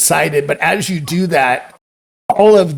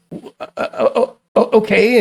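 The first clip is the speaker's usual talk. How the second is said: breathless startled stammer rising high; flustered stammer; 6 semitones higher than usual. Acted. Lauren fumbling as she hands over her spear, flustered. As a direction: caught off guard, stumble into yes.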